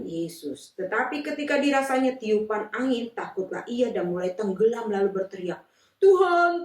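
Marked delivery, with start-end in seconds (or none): 6.0-6.6 loud, high plea for help; desperate cry. Acted. Peter sinking in the waves, calling out loud. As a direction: call out as you sink.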